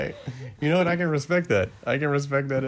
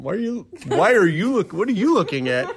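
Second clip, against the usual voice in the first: mock indignant; 6 semitones higher, 6 dB louder than usual. Side eye, mock indignant.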